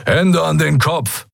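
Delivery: high, drawn-out command; demanding order. A narrator's reading. Pirate to the arriving visitors, demanding surrender. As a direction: demand it slowly and sharply. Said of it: "forderte ein dunkelhäutiger Mensch".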